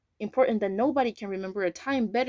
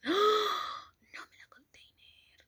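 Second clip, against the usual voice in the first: high, slow, hushed whisper of shock; gasp, then whisper it aghast; 12 semitones higher, much slower than usual. Real, not acted. Reacting to thought police reveal.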